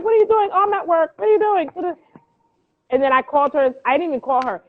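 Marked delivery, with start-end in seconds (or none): 0.0-1.7 higher, nagging mom voice; pestering mother on the phone; mimicking mom. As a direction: play the worried mother checking in. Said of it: "She's like".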